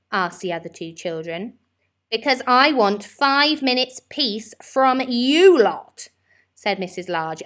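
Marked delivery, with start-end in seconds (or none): 2.1-6.1 louder, slow and weary; exasperated mum. A narrator's reading. Mother telling her children to leave her alone, loud and slow. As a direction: spell out each word, fed up.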